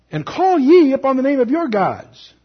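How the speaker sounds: slow, high, loud proclamation; declaimed challenge. Acted. Prophet challenging Baal's priests, slow ringing challenge. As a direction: throw down the challenge, drawn out.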